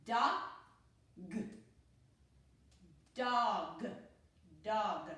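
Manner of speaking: "dog" built sound by sound with a short "ah" vowel. Shown as a demonstration. Teacher showing pupils that O says ah, sounding out "dog". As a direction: say "dog" in separated sounds, then blend it. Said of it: "So O says ah".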